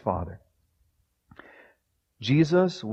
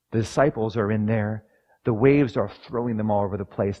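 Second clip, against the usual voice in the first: usual speaking voice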